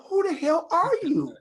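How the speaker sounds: high, loud, stunned cry of disbelief; shocked disbelief. Retold or imitated. Husband stunned by wife's sudden Irish accent, shocked outcry. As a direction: blurt it out as if a stranger sat beside you. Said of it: "I'm like".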